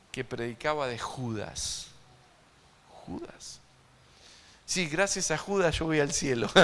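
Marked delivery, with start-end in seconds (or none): 3.1-3.6 stage whisper of the name. Acted.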